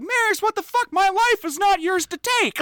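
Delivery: hammed-up friends berating Marius; outraged mock yell. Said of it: "they're like".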